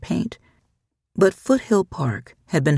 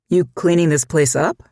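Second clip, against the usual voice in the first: a touch of disbelief, a bit louder; incredulous; 2 semitones higher, 4 dB louder than usual. A narrator's reading. Cop finding her cleaning the greenbelt, incredulous. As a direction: ask it as if you can't quite believe it.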